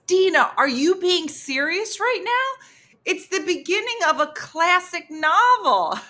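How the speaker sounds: high, loud incredulous mimicry; exasperated. Partner challenging her about the classic novel, exasperated. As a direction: imitate him aghast at her ignorance. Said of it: "his exasperation".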